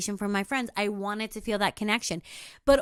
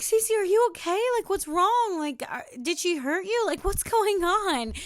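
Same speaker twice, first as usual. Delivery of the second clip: higher-pitched imitation of alarmed boyfriend; worried partner; 8 semitones higher, 3 dB louder than usual. Boyfriend asking if she is hurt, alarmed. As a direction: voice him in a panic. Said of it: "he was like".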